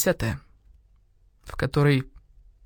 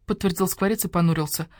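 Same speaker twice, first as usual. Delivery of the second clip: ordinary delivery, nothing marked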